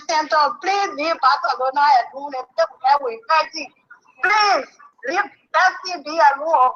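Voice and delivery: ordinary delivery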